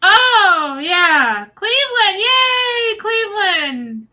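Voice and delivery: high, loud fake-excited squeal; mock cheering. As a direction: cheer like an over-eager crowd, then let it sag. Mocking everybody's forced cheer.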